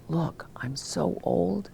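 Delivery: slow soft whisper. Real, not acted. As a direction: whisper the aside slowly, amused at herself. Aside about her age.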